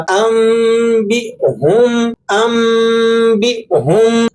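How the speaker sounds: ام then a short glottal break before بہم, slow and drawn; correct Quranic recitation. Shown as a demonstration. Teacher shows students the correct reading, slow recitation. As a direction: recite ام, pause with a small catch, then بہم. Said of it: "مثال کے طور پر ابھی دیکھیے گا".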